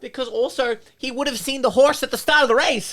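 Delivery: high, loud and indignant; 1 excited outburst. Real, not acted. Friends ranting loudly about the plot hole, excited shout.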